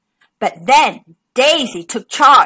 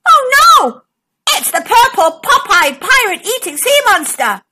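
high, loud alarmed cry; captain crying out at the monster; gasped in alarm; 7 semitones higher, 3 dB louder than usual. Acted. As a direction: panic at the monster. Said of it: "gasped Captain Kodai".

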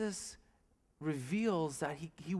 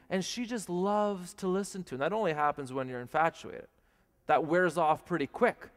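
normal talking voice

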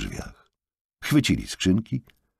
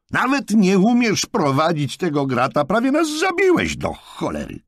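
much higher, louder furious yell; 5 dB louder than usual. A narrator's reading. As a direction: yell at the bad driver. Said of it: "wrzasnął Jonathan". (wściekły wrzask)

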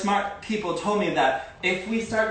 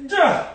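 duh; 12 semitones higher, 6 dB louder than usual. Real, not acted. Sudden exclamation in instruction.

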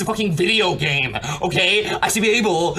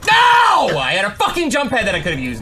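furious shouted rant; raging gamer; 4 dB louder than usual. Real, not acted. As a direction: yell it out in gamer rage. Gamer raging after a death.

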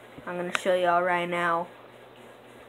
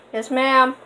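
louder answer, pitched up; raised reply to a grown-up; calling back; 6 semitones higher, 11 dB louder than usual. Real, not acted. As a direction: call back to mom to acknowledge. Answering a call from offscreen.

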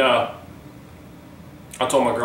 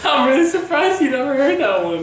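high, laughing teasing; 14 semitones higher, 4 dB louder than usual. Real, not acted. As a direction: tease while laughing. Laughing after Roberto joke.

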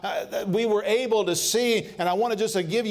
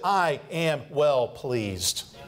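very slow, lowered, solemn; voice of God; 5 semitones lower, much slower than usual. Retold or imitated. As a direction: let it rest heavy and calm. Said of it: "God said".

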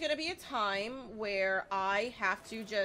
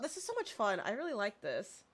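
usual speaking voice

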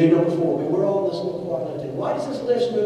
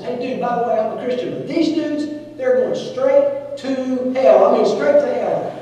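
higher, louder blurt of a ski dude; lightly voiced ski dude; 6 semitones higher, 5 dB louder than usual. Ski dude blurting to the preacher, mock outburst. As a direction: bust out like a blunt young guy. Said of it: "dude number four leans out and says".

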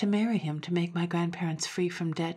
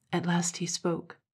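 usual speaking voice

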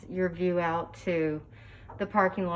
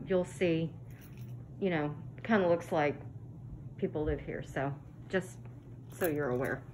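ordinary talk, nothing marked

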